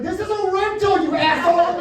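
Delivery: high, furious yell at the fleeing deer; angry shout. Retold or imitated. Shouting at a deer from the car window, furious high yell. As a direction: scream it out the window. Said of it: "I shouted".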